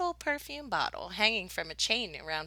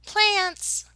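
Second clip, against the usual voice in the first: high, bright called word; Mama calling a game to the children; 12 semitones higher, 4 dB louder than usual. Acted. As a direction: call it like a game starter. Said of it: "she called out".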